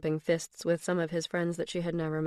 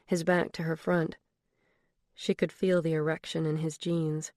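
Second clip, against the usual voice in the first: ordinary delivery, nothing marked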